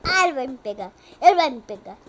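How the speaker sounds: loud panicked wail. Real, not acted. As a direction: cry out as it closes in. Pânico, monstro perto. (pânico gritado)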